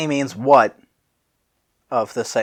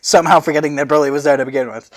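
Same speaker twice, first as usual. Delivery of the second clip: ordinary delivery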